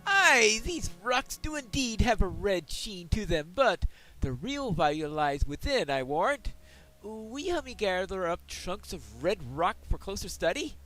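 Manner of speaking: loud, hearty dwarf prospector. Acted. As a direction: boom it out like a proud dwarf. Voices the dwarf quest giver.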